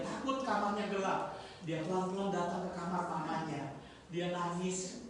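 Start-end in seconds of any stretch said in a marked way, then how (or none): none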